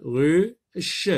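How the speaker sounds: "ruche" as ru, pause, che; split into syllables. Shown as a demonstration. Teacher showing the second syllable, "ruche" pulled apart. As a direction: stretch "ruche" into two beats. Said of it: "Dans la deuxième syllabe".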